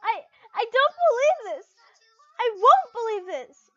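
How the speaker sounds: high, loud, crying disbelief; tearful sniffle. A narrator's reading. Heartbroken girl refusing to believe her crush, crying. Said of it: "she sniffled".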